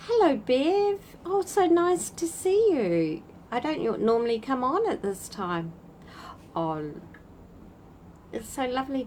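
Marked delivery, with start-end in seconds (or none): 0.1-3.2 excited greeting. Real, not acted. Greeting a viewer on live.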